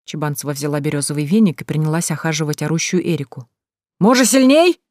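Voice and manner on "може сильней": raised voice, pitched up into a question; eager loud offer to go harder; loud high question. A narrator's reading. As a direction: call it out high, rising sharply at the end. Teasing offer to whip harder.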